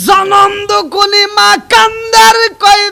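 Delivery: loud, high sermon chant; full-force preaching. A narrator's reading. Preacher introduces the weeping mother, loud high chant. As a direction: belt the narration out high.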